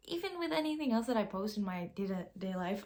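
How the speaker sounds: ordinary delivery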